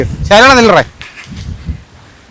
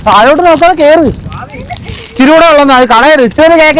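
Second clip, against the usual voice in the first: calling out; 6 semitones higher than usual. Real, not acted. Urging friends up the hill.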